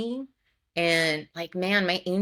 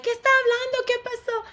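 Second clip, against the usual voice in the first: much higher, alarmed mother voice; imitating worried mom; 4 dB louder than usual. Mom calling back, imitated in a high alarmed voice. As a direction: squeak it out like a panicked Mexican mom. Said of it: "my mom was like".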